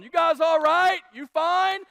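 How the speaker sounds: high, very loud captain's yell. Retold or imitated. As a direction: shout it as if over waves and engine. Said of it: "he keeps yelling".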